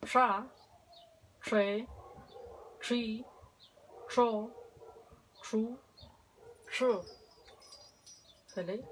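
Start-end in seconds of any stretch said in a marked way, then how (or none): 0.0-6.9 "sha, she, shi, cho, shu, shi" separadas y claras; sílabas con sh. Shown as a demonstration. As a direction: marca cada sílaba con sh. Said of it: "Kakiñe". (práctica de sílabas con sh)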